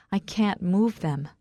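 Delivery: usual speaking voice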